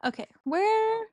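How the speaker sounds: sudden high, loud and slow okay; surprised. Real, not acted. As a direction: caught off guard, searching the screen. Caught off guard in the game.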